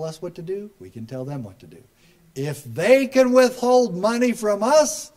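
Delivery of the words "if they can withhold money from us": higher, louder, slow and punchy; slow emphatic shout. Real, not acted. Speaker rallying the crowd against federal funding threats, slow loud shout. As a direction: hammer each word home like a rally cry.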